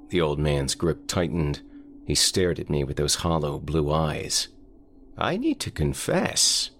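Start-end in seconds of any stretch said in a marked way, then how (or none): 5.2-6.7 put-on high, slow, quavering old man. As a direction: croak it like a frail old man. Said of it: "he rasped".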